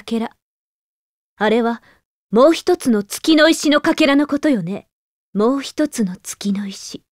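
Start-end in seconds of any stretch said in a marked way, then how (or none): 5.3-7.0 slow whispered echo to herself; hushed musing whisper. Acted. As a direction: repeat it under your breath, slowly. Repeats phrase under her breath.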